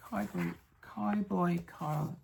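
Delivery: slow, laboured "Cowboy, Cowboy Carl"; struggling with pronunciation. Real, not acted. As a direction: take "Cowboy, Cowboy Carl" slowly, wrestling with each word. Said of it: "That really challenges my accent".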